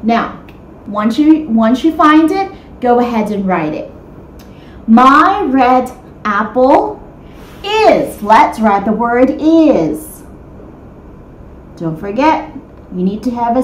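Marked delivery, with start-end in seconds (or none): 4.9-8.2 slow reread. Shown as a demonstration. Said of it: "rereads sentence slowly".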